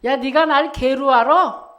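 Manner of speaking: Goliath's taunt. Retold or imitated. Preacher replaying Goliath's affront for the congregation, loud mock challenge. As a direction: throw out the giant's indignant question. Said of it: "이 말이잖아요".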